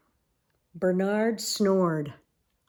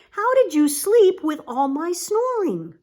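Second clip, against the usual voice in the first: higher character voice; 8 semitones higher, 4 dB louder than usual. Acted. Bernard asks the bats.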